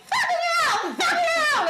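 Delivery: very high mock yell; high-pitched retold outburst; top-of-the-voice frustration. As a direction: let out a high, comic cry of frustration. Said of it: "at the top of my voice".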